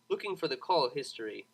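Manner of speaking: plain reading voice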